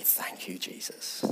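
breathy whisper of thanks to Jesus; hushed grateful whisper. Acted. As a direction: whisper it like a private prayer. Quiet prayer of thanks.

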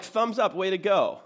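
high, loud congratulations; acted cheering. Voicing someone cheering another on.

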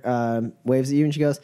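ordinary delivery

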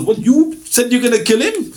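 high incredulous question; surprised onlookers, hammed up; mock disbelief. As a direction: pitch it up in comic disbelief. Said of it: "the people goes".

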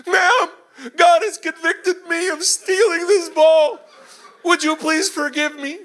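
loud, very high, slow kid imitation; child's wailed confession. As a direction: wail the rehearsed apology like a distraught boy. Son delivers rehearsed apology loudly.

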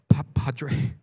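breathy hushed whisper of one word; retold whisper. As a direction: barely breathe the title out. Old friend whispers priest's title.

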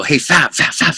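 imitated attention-getting call; urgent calling for attention. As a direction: nudge a friend to look, mock-urgent. Calling a bandmate's attention across room.

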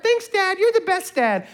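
put-on very high, sweet girl's voice; imitating daughter. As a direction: chirp like his adoring daughter. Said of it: "when I was her".

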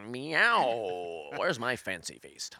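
a hint of a pampered cat. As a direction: purr it like a lazy cat. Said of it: "He is part cat".

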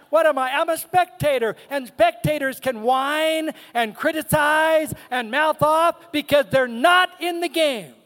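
emphatic, high-pitched pulpit delivery; forceful preaching. Real, not acted.

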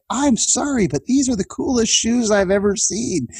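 high-pitched mock admiration; imitated speaker teasing. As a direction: gush over the shoes in a high playful voice. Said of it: "and he said".

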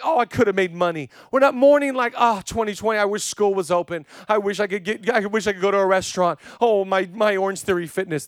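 high, loud mock wailing over petty losses; mock lamenting. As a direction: whine your complaints in mock grief. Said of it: "this is my lamenting now".

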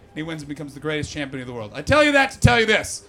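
ordinary talk

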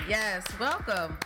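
normal talking voice

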